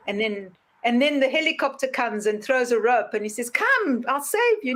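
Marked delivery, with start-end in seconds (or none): 3.5-4.7 higher call-out; calling rescuer. Retold or imitated. Helicopter rescuer calling down to man, higher. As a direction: offer the rope brightly.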